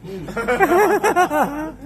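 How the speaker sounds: normal talking voice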